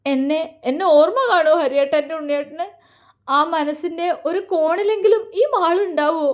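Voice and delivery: put-on young woman pleading while crying; crying question. A narrator's reading. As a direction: ask through tears, voice lifting. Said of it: "അവൾ കരഞ്ഞുകൊണ്ട്".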